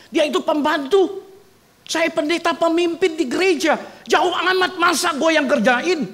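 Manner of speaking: high, loud, rising sermon passion; fiery preaching. Acted. Pastor rousing the congregation, loud impassioned preaching. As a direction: build the point up to a ringing climax. Preacher building to full force.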